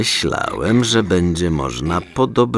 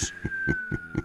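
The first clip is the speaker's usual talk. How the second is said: high eerie cackle; sinister laugh; 13 dB quieter than usual. Acted. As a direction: laugh darkly at the threat. Menacing Santa laughs at threat.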